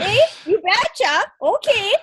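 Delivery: put-on hammy Minnesota accent, upbeat; Minnesotan accent. Wife finishing her Minnesotan parody, mock Minnesotan accent. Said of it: "Minnesotan accent".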